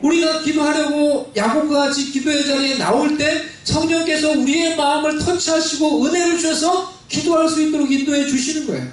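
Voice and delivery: lifted, fervent sermon delivery; climbing preacher's cadence. Acted. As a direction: preach it up toward the congregation. Preaching build-up.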